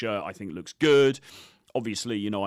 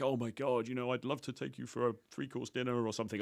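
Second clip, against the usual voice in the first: overly serious; 6 dB quieter than usual. Retold or imitated. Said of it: "It's not this, like, super".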